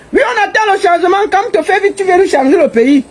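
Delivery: high-pitched mock plea of idle citizens; mocking bar-goers. Baroness mimics Cameroonians waiting in bars for Kamto, mocking high voice. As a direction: imitate complacent drinkers, voice pitched well up. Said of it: "ils sont assis là, chacun au bar".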